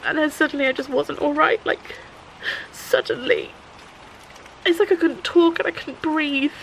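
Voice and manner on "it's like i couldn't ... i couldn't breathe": tearful recollection. Real, not acted. Reliving a panic moment.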